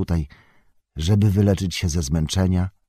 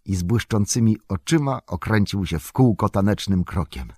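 ordinary delivery, nothing marked

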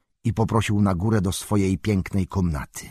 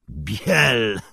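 mocking cry. A narrator's reading. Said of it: "krzyknął drwiąco".

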